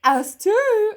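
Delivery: very high, loud bird chirp; bird voices. A narrator's reading. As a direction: pipe up as little birds. Birds character voice.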